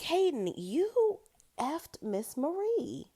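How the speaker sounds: high, slow disbelieving rebuke; mock incredulous. Host calling out Hayden's double standard, incredulous. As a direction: stretch it out way up high.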